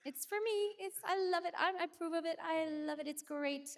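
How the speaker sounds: bright high inner voice hyping herself up; high self-pep talk. Retold or imitated. Singer voicing her own reaction to a new song, high and cheerful.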